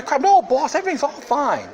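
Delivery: mock underling insisting to the boss, very high; high-pitched fawning servant parody; servile peasant. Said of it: "A lot of places say".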